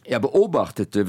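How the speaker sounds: usual speaking voice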